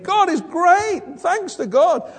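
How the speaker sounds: high, loud, drawn-out cheer; jubilant praise. Acted. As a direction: exult at full voice. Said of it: "that's what she was saying".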